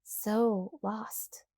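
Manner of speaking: ordinary delivery, nothing marked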